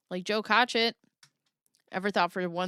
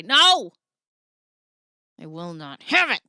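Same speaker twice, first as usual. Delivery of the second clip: mock outraged no; 3 semitones lower, 6 dB louder than usual. Joking on Mega Logic Monday, loud mock refusal. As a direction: shout it as a playful ban.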